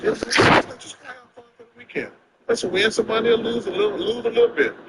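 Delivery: high-pitched imitation of friends reasoning with him; friends' coaxing. Friends sit a gambler down about Vegas, coaxing.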